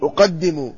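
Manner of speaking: أقدم with u, then a, then doubled d with i; vowelled as prescribed. Shown as a demonstration. Teacher showing al-Nawawi's vowelling, أقدم said as uqaddimu. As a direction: pronounce أقدم carefully as uqaddimu. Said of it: "ضبطناه بضم الهمزة وفتح القاف وكسر".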